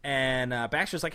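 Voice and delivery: ordinary delivery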